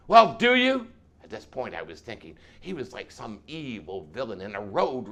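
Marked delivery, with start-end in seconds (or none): 0.1-0.9 high, loud challenge; explosive, insistent demand; demanding shout. Acted. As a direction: press them hard for an answer. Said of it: "He demanded of the room".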